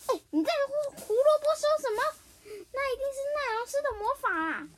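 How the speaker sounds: high, loud, rushed angry retort; a kid character losing his temper; angry outburst. Acted. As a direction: snap back in a fury at the others. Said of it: "阿潘就愤怒地说".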